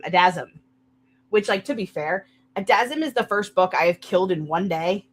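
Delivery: ordinary talk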